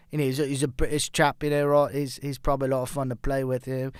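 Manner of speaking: a bad British accent, only lightly put on; a half-hearted British chap impression. Golf fan mocks Rory as a British chap, in a bad British accent. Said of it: "that was a terrible accent".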